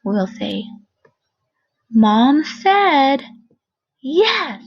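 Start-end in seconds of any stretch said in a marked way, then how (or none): 1.9-4.6 bright, loud and high, full of excitement; excited reading. Shown as a demonstration. Teacher reading a sentence to first graders, excited. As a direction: read it like big news to share. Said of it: "You see how I changed my voice?".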